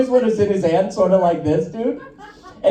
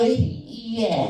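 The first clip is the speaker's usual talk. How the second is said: quiet mock yes; 2 semitones lower, 6 dB quieter than usual. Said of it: "I'm gonna go".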